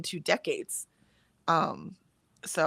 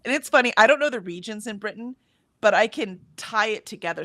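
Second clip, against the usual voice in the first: ordinary delivery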